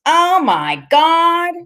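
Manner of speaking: high, loud, drawn-out exclamation; gleeful high shriek, every word held long; whooping shriek. A narrator's reading. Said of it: "he's shrieking and laughing and whooping it up so loud".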